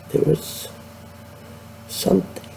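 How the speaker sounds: interrupted by hiccups; hiccupping, breathy and quiet. Real, not acted. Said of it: "I've got the hiccups".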